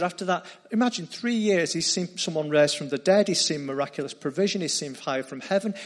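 usual speaking voice

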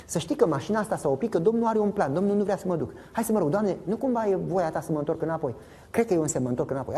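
a lightly voiced pastor talking himself round; Balaam reasoning. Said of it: "Zice așa".

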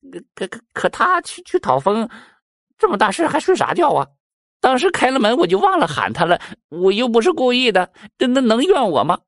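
high, stammering, tearful whine; whiny crying excuse. Acted. Mouse wails excuses to King Yama, high tearful whine. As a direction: stutter out the excuse in a high, half-sobbing voice. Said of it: "这耗子又哭了".

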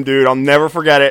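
usual speaking voice

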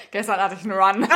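cracking up at her own blunder; amused laugh-filled line. Real, not acted.

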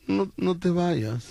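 slow, hammed-up begging, breaking into a laugh; mock crying plea. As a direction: pretend to sob out the plea, slowly. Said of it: "casi llorando me decía".

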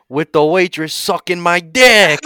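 loud, high boast, drawn out; rowdy bragging. Acted. Frat guy brags to the AA meeting, loud and slow. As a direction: build to a rowdy punchline.

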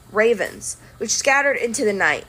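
ordinary delivery, nothing marked

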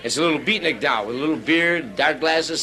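usual speaking voice

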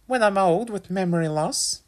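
high-pitched mother voice; teasing mum. A narrator's reading. Mum teases son.

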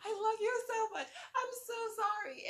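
high, slow, mock-tearful apology with laughter; half-laughing near tears. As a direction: choke up while laughing at yourself. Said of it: "about to cry".

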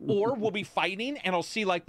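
normal talking voice

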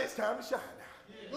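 voice of God. Retold or imitated. God telling the believer to shine, slow and soft. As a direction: gently urge them to shine. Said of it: "God looked down from heaven and said".

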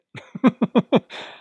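a sudden high burst of laughter; bright high laugh; real laugh. Real, not acted. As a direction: laugh out loud at the joke. Laughing at retold taxi exchange.

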